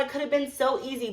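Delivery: ordinary talk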